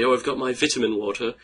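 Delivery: put-on English accent, lifted in pitch; strong English accent. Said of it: "very strong English accent".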